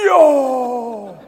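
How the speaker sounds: loud, high, startled "Yo!"; surprised shout. Retold or imitated. Preacher startled when the wind suddenly dies, loud yelp. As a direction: yelp in sheer amazement.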